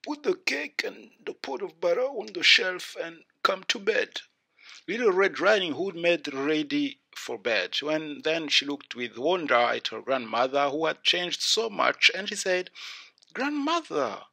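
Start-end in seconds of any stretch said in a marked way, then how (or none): 0.0-4.2 higher put-on granny voice from the wolf; wolf playing granny, pitched higher; muffled wolf voice. A narrator's reading. Wolf under bedclothes calls to the girl, muffled voice. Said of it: "called out in a muffled voice". 13.3-14.3 put-on very high child's voice; wondering little girl. A narrator's reading. Girl wonders at changed grandmother.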